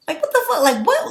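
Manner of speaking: what-the-hell incredulity; incredulous. Real, not acted. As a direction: pitch up in outraged disbelief. Outraged disbelief at post.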